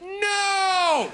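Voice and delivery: high, drawn-out mock-horrified no; emphatic refusal. Uncle refusing his nephew's request, emphatic. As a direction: stretch one high, indignant refusal.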